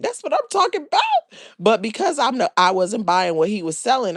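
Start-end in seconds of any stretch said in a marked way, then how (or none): 0.0-1.4 pretend cheer. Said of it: "I would have been like".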